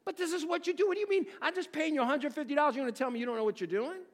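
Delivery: high, fast, exasperated disbelief; a high, rushed, outraged customer; indignant customer. Retold or imitated. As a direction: demand to know why you paid. Angry customer confronting the tradesman.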